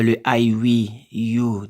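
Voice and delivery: ordinary talk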